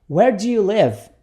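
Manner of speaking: connected, moderately slow question; repeat-after-me model. Shown as a demonstration. Teacher repeats the question for students, joined and measured. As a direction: say it as one measured phrase. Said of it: "Repita comigo".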